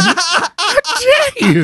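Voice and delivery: high, drawn-out laughing outrage; mock indignation. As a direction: play offended through helpless laughter. Mock offence at co-host's dog remark.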